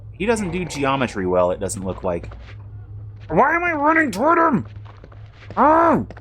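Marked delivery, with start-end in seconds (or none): 3.3-6.1 alarmed. Real, not acted. Panicked at own character.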